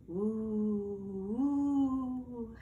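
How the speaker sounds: long hollow woo of the wind; wind howl. A narrator's reading. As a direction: moan it like wind through trees. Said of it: "howled the wind".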